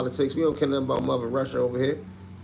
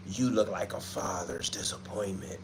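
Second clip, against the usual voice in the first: a hint of a horror movie villain, much softer; scary movie voice; 5 semitones lower, 10 dB quieter than usual. Streamer reading a troll's comment in a soft scary movie voice. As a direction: read the comment like a soft, creepy movie line. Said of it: "You sound like you write for a scary movie".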